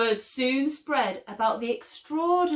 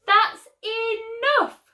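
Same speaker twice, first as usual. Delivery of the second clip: much higher, louder, slow furious yell; 13 semitones higher, 7 dB louder, slower than usual. A narrator's reading. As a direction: shout it as an outraged mother. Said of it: "Missus Brains yelled".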